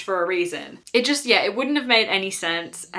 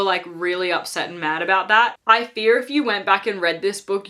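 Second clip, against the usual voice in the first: ordinary talk, nothing marked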